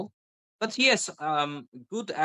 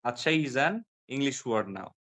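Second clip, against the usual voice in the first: normal talking voice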